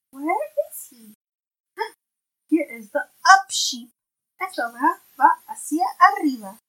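bright, clear picture-book reading; reading to a toddler. Acted. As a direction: read it aloud to a little one. Parent reads picture book aloud.

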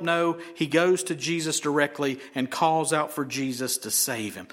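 usual speaking voice

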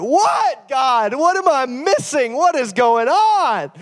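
high-pitched, drawn-out pleading wail; frustrated outcry, retold. Frustrated dad to God in the kitchen, exasperated high outcry. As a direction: cry out to heaven in exasperation.

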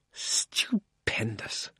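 whispered slowly, in rapture; dreamy whisper. A narrator's reading. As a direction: breathe it out, lost in a daydream. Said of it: "in a happy dream".

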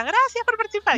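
high, bright, loud mock announcer line; radio host. DJ thanking the failed caller, hammed-up announcer voice. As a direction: brush her off like a cheerful DJ.

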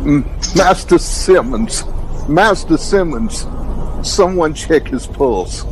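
alarmed. Acted. Servant finds master collapsed.

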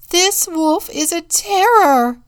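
high, loud cry of alarm; screamed. A narrator's reading. Contessa alarming the town about the wolf, screamed. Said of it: "screamed Contessa de Gubbio".

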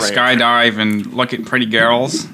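slow, drawn-out Australian introduction; Australian accent. Pretend. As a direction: introduce yourself in a put-on Aussie accent. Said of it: "Who can do the best Australian accent?".

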